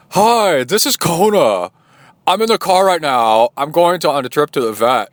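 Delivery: high, loud comic voice for the family dog; fake dog voice. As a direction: talk as the pet dog on the phone. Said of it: "we do this fake voice for Kona".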